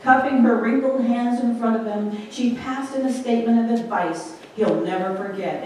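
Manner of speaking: ordinary talk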